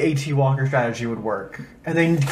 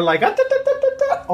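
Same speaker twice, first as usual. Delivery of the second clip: kung fu noises; faster than usual. Pretend. Kung fu fight sound effects.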